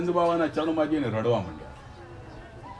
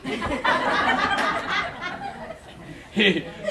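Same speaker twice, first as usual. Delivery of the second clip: big high burst of laughter; laughing hard at his own punchline; hearty laugh. Real, not acted. The preacher laughs at the miser's last words.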